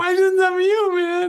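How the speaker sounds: high, teary wail of hurt; mock crying protest. Plays hurt self, crying shout.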